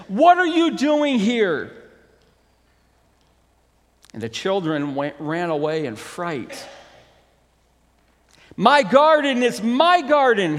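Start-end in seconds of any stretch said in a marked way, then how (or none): 0.1-1.7 loud, high giant's yell; gruff yell. Acted. Giant catching children in his garden, gruff acted yell. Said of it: "yelled at them in a very gruff voice". 8.5-10.6 loud, high possessive giant; storybook yell staking a claim; acted yell. Giant claiming the garden as his own, acted yell. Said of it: "he yelled".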